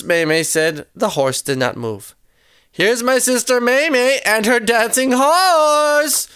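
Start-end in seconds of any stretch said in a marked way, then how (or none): 2.7-6.3 repeated stage announcement. A narrator's reading. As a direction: announce it to the crowd, stretching "horse". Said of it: "Rose said again".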